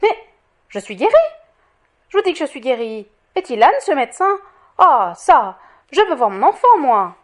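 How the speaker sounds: loud, indignant protest rising in pitch; exasperated, building to a loud demand; impetuous outburst. A narrator's reading. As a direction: break in hotly and insist. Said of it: "Elle l'interrompit impétueusement".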